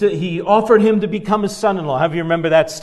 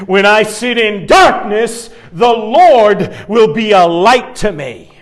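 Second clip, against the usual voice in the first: scripture reading; 5 dB louder than usual. Acted. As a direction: proclaim the verse, building on each line. Reading Micah aloud.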